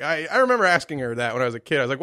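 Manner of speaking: normal talking voice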